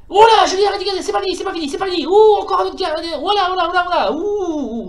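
real excitement over the save; excited. Real, not acted. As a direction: get swept up in the action.

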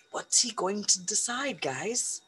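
usual speaking voice

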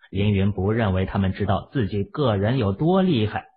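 ordinary delivery

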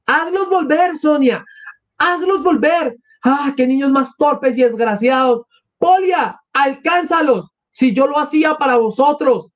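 high-pitched pleading call; acted desperate wail; frantic cry. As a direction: cry out high after runaway children. Mother crying after fleeing children.

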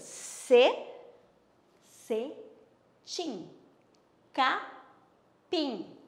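Ce split off before cetim, then capim; syllable demo. Shown as a demonstration. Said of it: "Elas têm duas sílabas".

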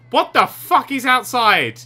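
loud, high, slow exclamation; alarmed disbelief. Real, not acted. Player seeing something creepy outside, alarmed disbelief. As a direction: stretch it out, loud and aghast.

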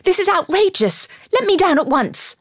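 high, loud indignant protest; outraged cry. A narrator's reading. Woman carried off over a shoulder, indignant cry. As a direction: demand release at full outraged pitch. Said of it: "I cried".

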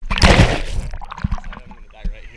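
panicked cry. Real, not acted. Struggling in cold water.

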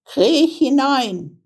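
slow, high witch order; high, drawn-out witch command. A narrator's reading. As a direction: coax the girl in with a drawn-out witch voice. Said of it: "sagte die Hexe".

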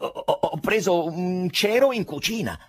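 stammered start, then high and insistent; high, agitated defensive answer; flustered. A narrator's reading. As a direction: trip over the first word, pitch jumping up. Ivan flustered answering the doctor.

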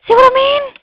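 very high, loud mock demand; silly loud squawk. Player asks the viewers about the window, silly squawk. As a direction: blare the question in a silly high voice.

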